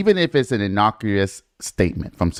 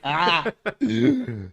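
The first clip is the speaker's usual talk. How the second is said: laughing; 8 semitones higher, 3 dB quieter than usual. Real, not acted. Laughs at partner's comeback.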